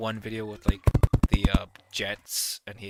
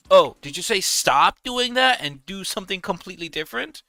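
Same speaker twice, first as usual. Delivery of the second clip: higher, louder mock-innocent question; sarcastic; 8 semitones higher, 9 dB louder than usual. Real, not acted. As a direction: drip with sarcasm.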